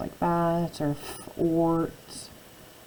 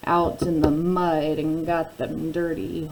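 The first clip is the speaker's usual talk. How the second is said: slow teaching voice; 4 dB louder, much slower than usual. Shown as a demonstration. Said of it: "The next word is war".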